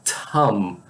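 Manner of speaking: "Tom" with an airy, aspirated T; native English T. Retold or imitated. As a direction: say "Tom" like an English speaker, puff after the T. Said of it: "pessoa de língua inglesa nativa".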